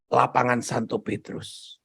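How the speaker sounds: normal talking voice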